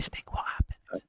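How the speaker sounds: unvoiced-breath whisper, drawn out. Real, not acted. Co-host secretly asking what happened, whispering. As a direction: whisper the question slowly. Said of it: "why are we whispering?".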